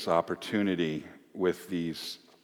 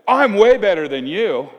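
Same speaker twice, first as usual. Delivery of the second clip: loud, high bragging; boastful shout; 9 semitones higher, 9 dB louder than usual. Acted. Disciple boasting loudly to his rival. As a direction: crow it over the others.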